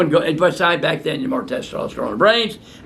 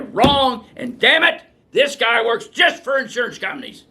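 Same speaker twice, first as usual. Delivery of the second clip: angry outburst; 7 semitones higher than usual. Real, not acted. Angry rant about insurance doctor.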